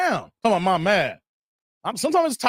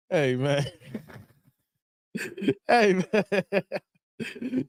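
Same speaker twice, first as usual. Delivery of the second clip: hey, man through laughter; laughing; 3 semitones lower, 4 dB quieter, much slower than usual. Real, not acted. Streamer cracking up at his chat, laughing words. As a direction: let the laugh carry the words.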